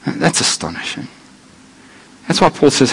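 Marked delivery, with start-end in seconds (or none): 0.1-1.1 awed hush. Real, not acted. Awe after sermon point.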